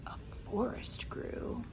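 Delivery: whispered storybook wonder; hushed. A narrator's reading. Reading to a child, whispering the magic part. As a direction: breathe it like a secret.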